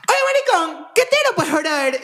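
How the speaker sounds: very high comic bro voice; mock a pushy friend in a squeaky pitch; caricatured high-pitched heckle; exaggerated impression of Lu. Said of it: "mi percepción de cómo yo te percibo".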